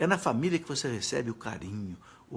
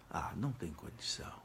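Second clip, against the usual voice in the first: much softer, lower whisper; whispered aside; 7 semitones lower, 10 dB quieter than usual. Real, not acted. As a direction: drop to a whisper, shaking your head. Rhetorical answer to the congregation. (sussurro baixo)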